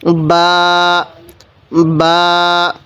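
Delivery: বা from both lips closed together, vowel held level; teacher's drawn-out demo of বা; two-lip articulation. Shown as a demonstration. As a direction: close the lips, release a long steady বা. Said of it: "দুই ঠোঁট একসাথে লাগিয়ে".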